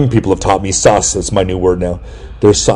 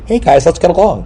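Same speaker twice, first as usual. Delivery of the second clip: higher-pitched goody-two-shoes peacemaker; lightly voiced chipper do-gooder; Ned Flanders; 7 semitones higher than usual. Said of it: "Like Ned Flanders from".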